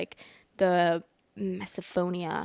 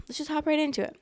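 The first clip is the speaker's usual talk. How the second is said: "Let's just hop right into it" said much higher, playfully lilting; sing-song repeat; 7 semitones higher, faster than usual. Real, not acted. Vlogger repeating herself to start the story, pitched up playfully. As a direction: bounce the repeat up high.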